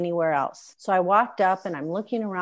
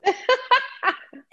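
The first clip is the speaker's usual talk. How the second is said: squealing laugh, much higher; high burst of laughter; 3 dB louder than usual. Real, not acted. Friend laughing at her own shop story, high laugh.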